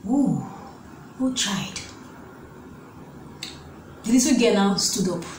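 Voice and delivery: normal talking voice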